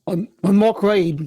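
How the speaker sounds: a light put-on Australian accent, slow; playful, slow Australian accent; fake Australian accent. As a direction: drawl it slowly in a mock Australian accent. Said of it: "that accent threw me off".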